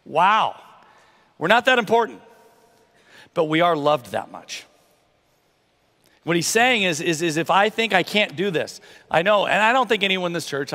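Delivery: usual speaking voice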